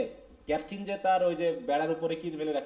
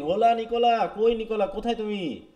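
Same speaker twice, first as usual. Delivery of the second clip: higher, louder acted call for a servant; acted shout, raised pitch; 5 semitones higher, 5 dB louder, slower than usual. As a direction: holler out for someone, half in earnest. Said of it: "There is Sergius shouting".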